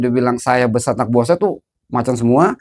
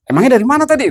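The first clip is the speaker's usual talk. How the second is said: high-pitched surprised question; startled sister; 10 semitones higher, 3 dB louder, faster than usual. Retold or imitated. Older sister questions her sick brother, imitated startled voice. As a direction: imitate her sudden alarm, pitch jumping up. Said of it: "Gitu, kaget dia".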